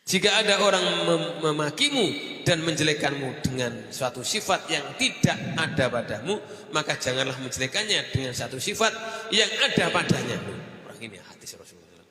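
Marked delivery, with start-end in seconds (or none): 0.0-10.4 loud, high, fervent preaching; preaching at full force. Acted. Preacher warning the congregation against arrogance, loud and fervent. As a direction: drive the warning home to the crowd.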